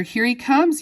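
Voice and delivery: high, animated call; excited yell. A narrator's reading. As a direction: yell the news to everyone. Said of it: "yelled Mister Wonka".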